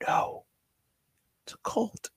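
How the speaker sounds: whispered. Retold or imitated. He's like, mock quote.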